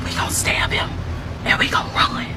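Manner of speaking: hushed, breathless whisper of a plan. Retold or imitated. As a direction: whisper it so the kidnappers can't hear. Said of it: "lean down and tell your sister".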